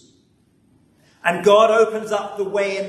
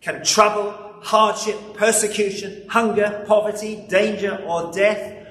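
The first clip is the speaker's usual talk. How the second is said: raised, ringing Bible reading; scripture reading; 3 semitones higher than usual. Acted. Preacher reads Paul's words to the congregation, ringing and raised. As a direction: proclaim the passage to the congregation.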